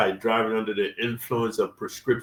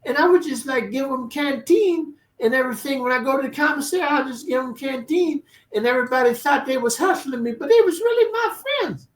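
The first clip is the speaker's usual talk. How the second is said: very high, squeaky mocking voice; 12 semitones higher than usual. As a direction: imitate a naive, timid dude in falsetto.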